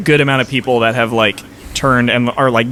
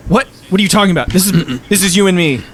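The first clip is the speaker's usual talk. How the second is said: higher, indignant protest; taken aback; 5 semitones higher, faster than usual. Acted. Opponent answers the bard before the crowd, taken aback. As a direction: pitch it up in baffled objection.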